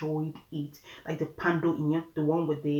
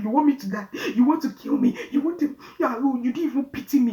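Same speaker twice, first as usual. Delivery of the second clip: loud, high, accusing panic; accusing scream; 8 semitones higher, 7 dB louder than usual. Retold or imitated. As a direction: yell it, feeling abandoned. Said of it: "she was just screaming".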